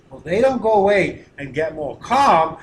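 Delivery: ordinary talk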